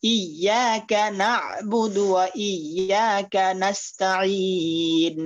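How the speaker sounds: ইয়াকা recited very slowly with a firm ya; slow tajweed recitation; slow model recitation. Shown as a demonstration. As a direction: chant ইয়াকা না'বুদু slowly for the students. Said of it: "ইয়াটা শক্ত হবে".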